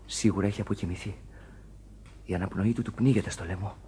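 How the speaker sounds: soft, low murmur over a dying man; hushed worry. Acted. Sailor murmuring softly to mates about dying friend's breathing. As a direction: speak barely above a breath.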